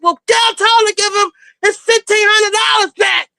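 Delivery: high-pitched mock yell; yelling back. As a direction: holler the demand back up the stairs. Retells Evelyn yelling back.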